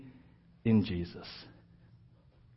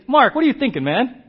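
loving challenge; 9 semitones higher, 8 dB louder than usual. Retold or imitated. Friend lovingly challenging Mark.

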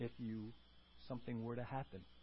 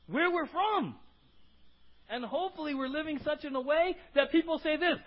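high, loud, driving sermon voice; emphatic preaching; 11 semitones higher, 8 dB louder than usual. Real, not acted. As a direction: preach it forcefully at a raised pitch. Preaches at full force.

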